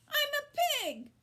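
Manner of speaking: put-on high, gleeful boy's cry; a boy yelling in the crate; cried out. A narrator's reading. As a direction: shout it in wild fun. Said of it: "cried Avery".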